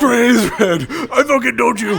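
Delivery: high, loud laughing insistence; shouting while laughing. Real, not acted. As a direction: yell it through laughter.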